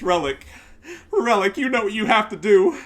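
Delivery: loud, high bossy mock order; mock demand. Mocking pro players ordering the devs, loud bossy parody. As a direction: boss the devs around in a parody.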